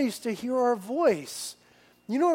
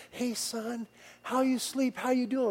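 tender father; 4 semitones higher, 6 dB quieter than usual. Retold or imitated. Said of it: "I'm grabbing his face".

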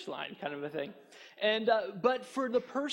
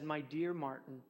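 wife's gentle rebuke; 6 semitones lower, 8 dB quieter than usual. Retold or imitated. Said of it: "And she said".

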